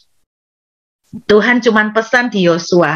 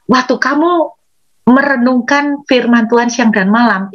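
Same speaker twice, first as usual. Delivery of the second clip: ordinary talk, nothing marked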